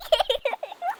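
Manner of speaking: put-on a high little-girl giggle; light high-pitched childish laugh; girl's laugh. Little girl laughing at George dancing, high giggle. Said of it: "The little girl saw him and laughed".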